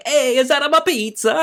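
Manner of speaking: very high, loud, drawn-out silly voice; stretched-out, high comic imitation; imitating daughter's pizza voice. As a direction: mimic a kid squealing a catchphrase. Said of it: "my daughter wearing just ... a t-shirt going".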